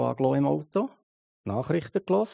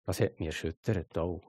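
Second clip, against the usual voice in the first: ordinary talk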